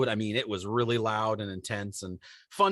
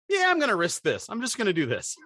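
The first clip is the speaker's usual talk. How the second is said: reckless resolve; 7 semitones higher than usual. Retold or imitated. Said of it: "to just be able to say".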